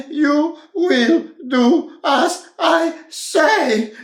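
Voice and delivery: high, loud, slow shout; bossy yell. Acted. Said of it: "shouted little Miss Bossy".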